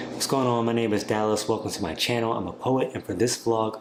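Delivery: normal talking voice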